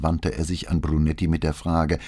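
usual speaking voice

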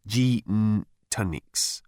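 "gin tonics" drawn out slowly, syllables apart; phonetic variant. A narrator's reading. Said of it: "variations on the same phonetic".

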